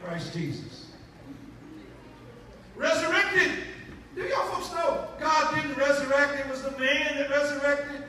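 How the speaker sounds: loud, fervent sermon delivery; preaching at full force. Acted. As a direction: drive the point home to the congregation.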